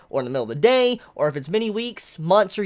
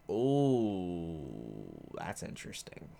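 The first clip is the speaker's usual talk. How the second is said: much softer, whispered "that's interesting"; hushed intrigue; 11 semitones lower, 12 dB quieter, much slower than usual. Real, not acted. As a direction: lean in and whisper it. Intrigued by villain's line.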